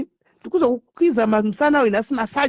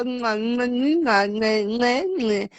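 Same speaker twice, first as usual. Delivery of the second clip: kiss sounds; much slower than usual. Shown as a demonstration.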